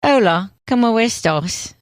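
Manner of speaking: Spanish with a British Michael Caine lilt; Michael Caine accent. Shown as a demonstration. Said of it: "It feels very Michael Caine".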